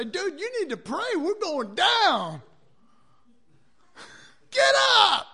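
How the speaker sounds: high, rising to a yell on get up; panicked wake-up. Retold or imitated. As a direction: shake him awake, panicking. Sailors waking Jonah in panic.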